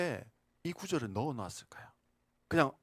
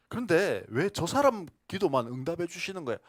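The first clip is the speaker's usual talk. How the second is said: louder, indignant retort; mock arguing; 4 semitones higher, 7 dB louder than usual. Believer indignantly argues back with God. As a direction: push back with a huffy demand. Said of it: "또 따졌어요".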